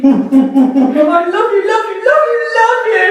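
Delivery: very high, loud, laughing love you; laughing dog voice. Pretend. Dog smothering its owner with love, laughing. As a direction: gush it through laughter like an overjoyed dog.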